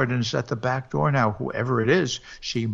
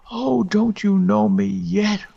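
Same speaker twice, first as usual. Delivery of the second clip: high, slow, louder wailing plea; ghostly wail; 8 semitones higher, 5 dB louder than usual. Acted. Ghost wife wails again at her frozen husband. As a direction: wail it, hurt he still does not know her. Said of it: "wailed the voice".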